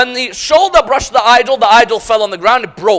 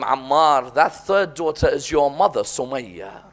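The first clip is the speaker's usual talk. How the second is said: hushed revelation; 7 semitones lower, 8 dB quieter than usual. Retold or imitated. Father reveals painful secret.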